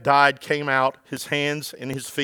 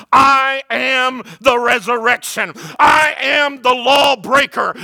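slow, loud, high, crying out each word; proclaiming shout; 10 semitones higher, 8 dB louder, slower than usual. Acted. Pastor proclaiming Jesus's words to the church, slow shouted cry. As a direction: thunder it out to the church.

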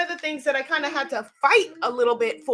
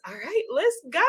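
ordinary talk, nothing marked

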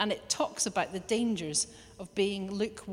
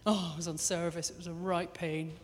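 a touch lower and softer, a mild moan; grumbling churchgoer; 4 semitones lower, 5 dB quieter than usual. Retold or imitated. Preacher voicing a churchgoer complaining about service, mild grumble. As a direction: moan lightly about a chore. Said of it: "an attitude of somebody that's like".

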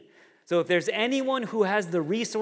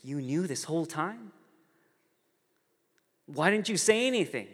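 mock incredulous; 3 semitones higher than usual. Boy learning parents knew all along, acted disbelief. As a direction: pitch climbing in disbelieving questions.

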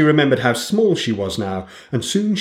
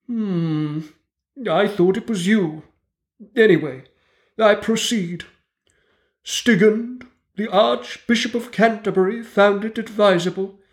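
pompous mouse lecturer; 8 semitones higher than usual. A narrator's reading. Mouse lectures the dripping animals, high and pompous. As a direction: lecture the wet animals grandly, like a tiny professor.

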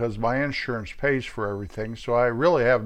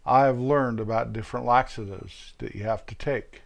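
usual speaking voice

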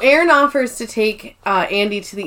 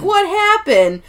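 mock worried Toby; 10 semitones higher, slower than usual. Toby asking about gunshot.

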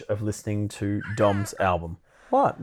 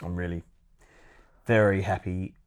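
sincere, soft praise; 6 semitones lower, 8 dB quieter than usual. Real, not acted.

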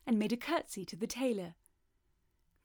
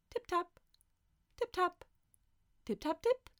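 high, crisp tip-tap imitation; tiny high taps in short bursts; little tapping noises; 9 semitones higher than usual. A narrator's reading. Storyteller imitating noises from the dresser, little high tappings. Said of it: "there came other little noises".

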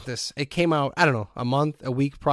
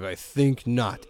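slow low refusal; slow measured Vader brush-off; 3 semitones lower, much slower than usual. Retold or imitated. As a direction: deny him with cold calm. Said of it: "he's like".

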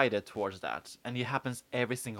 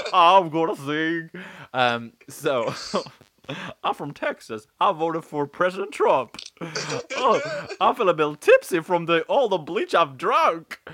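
brash vaudeville comic; 5 semitones higher, 8 dB louder than usual. Pretend. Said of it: "I'm a comedian".

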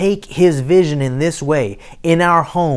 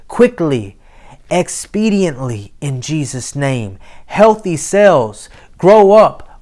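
commanding prayer. Acted. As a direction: rebuke it with authority. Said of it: "Speak to that thing".

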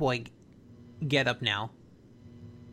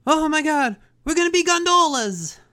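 loud, high cartoon outcry; mock panic; 9 semitones higher, 6 dB louder than usual. Cartoon plumber facing the river, loud mock panic. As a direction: cry out in exaggerated alarm, imitating a character.

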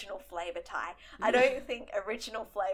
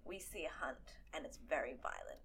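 hushed; 7 semitones lower, 12 dB quieter than usual. Real, not acted. Hushed dark point.

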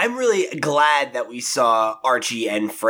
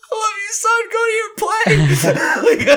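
very high, slow, choked-up imitation of a proud dad; a mock tearful father, high and drawn out, breaking into a laugh; imitating Fred; 3 dB louder, slower than usual. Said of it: "and he's just like".

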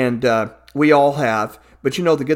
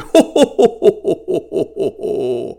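jolly ho ho ho; 2 semitones higher than usual. Acted. Santa laughing.

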